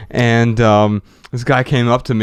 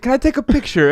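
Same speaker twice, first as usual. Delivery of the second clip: a hint of an Italian accent, pitched higher; foreign accent; 12 semitones higher, faster than usual. Italian woman asking the host for a photo, a hint of Italian accent. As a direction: lightly imitate an Italian accent.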